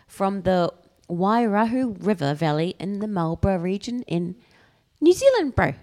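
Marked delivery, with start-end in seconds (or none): 5.0-5.7 bad mock New Zealand accent; hammy Kiwi accent, high and loud. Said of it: "That's a really bad New Zealand accent".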